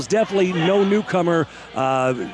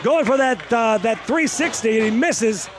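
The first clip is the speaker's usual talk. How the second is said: excited, higher play-by-play call; wrestling commentator; 4 semitones higher than usual. Acted. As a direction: call the big moves like ringside.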